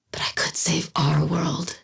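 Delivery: hushed, breathy whisper to herself. Acted. Quietly vowing to save her own world, whispered. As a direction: let the last line fall to a whisper. Private resolve after a speech.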